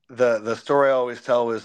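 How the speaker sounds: usual speaking voice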